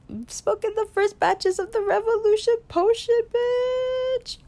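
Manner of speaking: high, cheeky brag; host hyping her own vape batch, sassy and high; sassy hype. Real, not acted. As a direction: tease it like a diva boasting.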